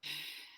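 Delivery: acted sigh. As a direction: sigh in character before getting up. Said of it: "And he sighs and gets up".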